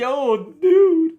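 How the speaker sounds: high, loud goofy greeting; drawn-out dude, pitched right up; Snoopy costume character. Acted. Costumed dog coming up to you, high and goofy. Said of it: "Boris Johnson dressed up as Snoopy".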